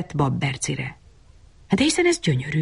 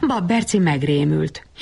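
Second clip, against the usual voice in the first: usual speaking voice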